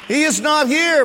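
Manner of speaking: loud, high preaching shout; full-force preaching. Real, not acted. Pastor preaching loud to his congregation. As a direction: proclaim it to the whole church.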